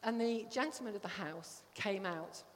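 ordinary talk, nothing marked

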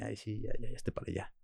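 quiet, dismissive brush-off, mock mom voice; indifferent mother. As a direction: brush the kid off like a bored mother. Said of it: "mi mamá también era muy indiferente".

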